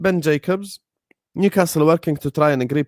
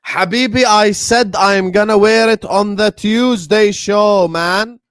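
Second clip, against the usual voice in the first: higher and louder, slow and insistent; loud exasperated outburst; 7 semitones higher, 8 dB louder, slower than usual. Real, not acted. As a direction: yell it at the chat, fed up but playful. Loud frustrated outburst at chat.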